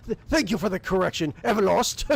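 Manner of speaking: a proud wolf warrior, amused; gruff warrior chuckling. Acted. Game master to players as Thorvald, jovial warrior voice.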